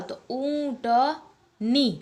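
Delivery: ऊंटनी said very slowly, leaning on नी; slow teaching demo. Shown as a demonstration. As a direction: stretch ऊंटनी syllable by syllable. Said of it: "तो ऐसे ही ऊंट".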